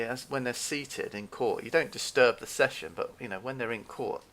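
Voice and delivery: usual speaking voice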